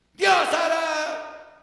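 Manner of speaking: loud, high and very slow; answering mockers with a loud, slow shout; defiant shout. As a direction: hold each word out in a defiant shout. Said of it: "me gritaron".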